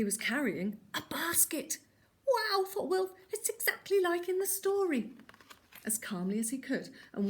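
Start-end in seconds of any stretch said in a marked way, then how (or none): 2.3-5.1 a high, delighted wow; thrilled it's just like the book; amazed wolf. A narrator's reading. Wolf delighted that Fred carries a basket, higher amazed voice.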